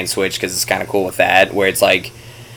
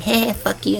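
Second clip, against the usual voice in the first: put-on bratty kid; 11 semitones higher, 8 dB quieter than usual. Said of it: "little twelve-year-old kid just like".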